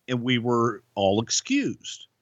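ordinary delivery

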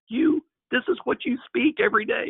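can't believe what he's hearing; incredulous outburst. Retold or imitated. Retelling his disbelief at friend.

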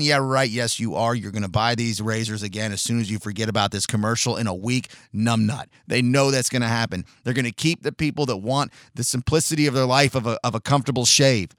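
ordinary talk, nothing marked

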